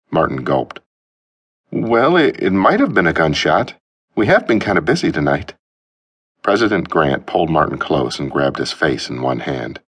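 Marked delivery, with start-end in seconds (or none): 1.7-5.5 put-on nervous young man. Martin's nervous voice.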